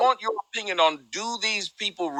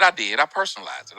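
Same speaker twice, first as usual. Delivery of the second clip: normal talking voice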